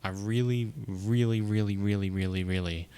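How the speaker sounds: insistent string of "really"; emphatic repetition. Real, not acted. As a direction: hammer each "really", then let it subside. Insists on wanting to try.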